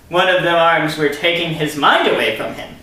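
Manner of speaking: ordinary delivery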